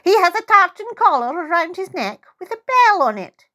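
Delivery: put-on high, eager old lady; helpful old woman. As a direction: add it brightly up high like a frail old woman. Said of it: "she adds helpfully".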